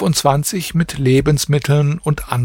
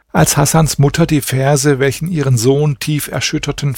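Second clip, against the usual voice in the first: plain reading voice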